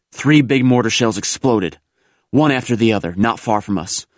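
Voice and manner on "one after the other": plain reading voice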